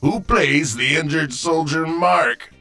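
ordinary talk

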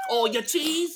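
high, mock street-seller accent; hammed-up, badly faked accent. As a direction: squawk it in a silly put-on accent. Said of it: "people see me going".

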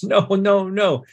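high, terrified pleading no; begging. Acted. Terrified priest begging at Zoyos's feet, high desperate pleading. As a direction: beg for your life. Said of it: "and he begs".

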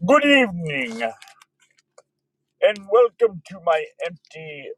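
mock TV host welcome; announcer voice. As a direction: open like a late-night host. Mock broadcast opening.

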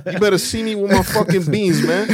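high, loud laughing mock threat. Threatening landlord voiced, laughing.

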